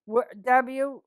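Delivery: usual speaking voice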